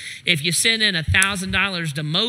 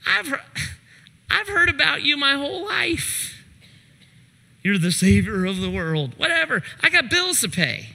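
dismissive mock; 7 semitones higher than usual.

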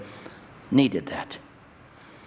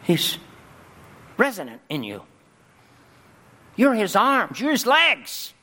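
climactic preaching; 10 semitones higher than usual. Acted. Pastor charging the congregation to a foot-washing ministry, in a high preaching shout. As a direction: hurl each line out to the back pew.

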